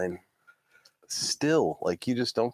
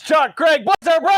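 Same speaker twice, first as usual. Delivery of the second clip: ringmaster shout; 7 dB louder than usual. Acted. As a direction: shout the names high and loud. Show opener announcement.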